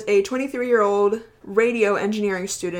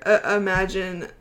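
normal talking voice

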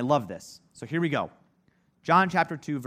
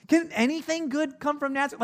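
high mocking disbelief; scoffing exclaim; 13 semitones higher than usual. Nathanael scoffing at Philip about Nazareth, high mock disbelief. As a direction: scoff like the town is a joke. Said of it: "exclaimed Nathanael".

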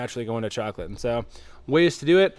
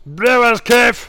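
put-on slow, high, loud Belgian accent on "Brewers Cafe"; exaggerated Belgian accent, drawn out; 9 semitones higher, 9 dB louder, much slower than usual. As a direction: stretch out "Cafe" like a hammed-up Belgian. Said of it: "you kinda nailed that one".

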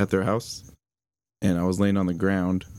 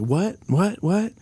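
high rising "what?", climbing with each repeat; retold shocked cries; 9 semitones higher, much slower than usual. Girlfriend hearing of the death, shocked and disbelieving. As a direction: mimic her mounting shock without really screaming. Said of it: "her mom hears her screaming".

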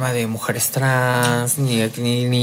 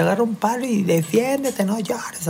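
scolding command, retold in a higher, softer voice; angry mother; 8 semitones higher, 5 dB quieter than usual. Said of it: "así bien enojada".

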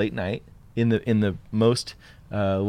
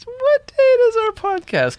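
mock falsetto; 6 dB louder, slower than usual. Host prompting co-host, mock-announcer.

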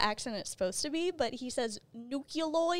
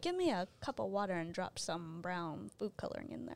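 mock hush; 6 semitones lower, 9 dB quieter than usual. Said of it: "You're like".

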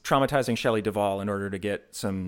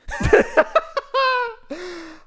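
loud high burst of laughter; 7 dB louder than usual. Real, not acted. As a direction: explode into laughter.

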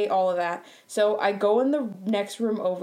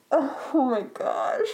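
high, drawn-out mortified groan; embarrassed cringe; 4 semitones higher, much slower than usual. Real, not acted. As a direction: cringe at your own story, stretching it out. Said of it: "I literally am having secondhand embarrassment".

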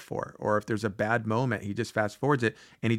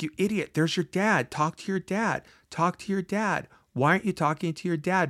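higher, urgent retold yell at his past self; mock yelling at himself; 7 semitones higher than usual. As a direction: scold yourself, pitched up, pressing. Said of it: "he starts yelling at himself".

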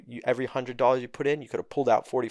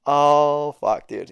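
ordinary delivery

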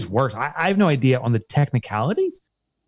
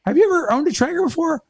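much higher, gushing Southern customer-service voice; excited Southern rep. Retold or imitated. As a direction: play the overjoyed phone guy from the South. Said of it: "because of the guy's accent".

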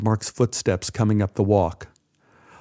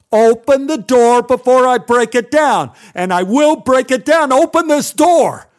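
high, loud pounding threat; enraged shouting; furious shout; 12 semitones higher, 7 dB louder than usual. Acted. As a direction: bellow it through the door. Mark pounding, threatening door.

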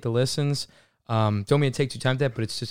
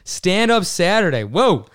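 higher, louder segment hype; hyped announcement; 7 semitones higher, 6 dB louder, slower than usual. Real, not acted. Host introducing the weekly stand-up segment, hyped up. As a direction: punch up the show title with excitement.

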